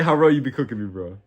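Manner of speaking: normal talking voice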